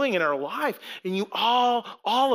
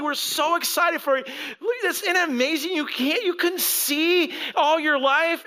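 high, sing-song fake enthusiasm; mock cheerful; 6 semitones higher than usual. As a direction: sound like the cheerful welcome they never gave. Sarcastic mock of the Pharisees.

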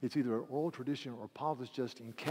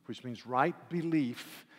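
usual speaking voice

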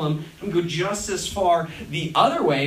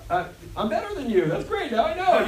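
higher-pitched fake-nice superiority; mock condescension; 6 semitones higher than usual. Acquaintance silently judging the odd-job worker, mock condescension. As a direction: look down on them while sounding pleased. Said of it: "you could see in their eyes".

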